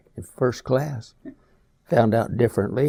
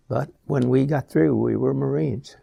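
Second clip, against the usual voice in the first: normal talking voice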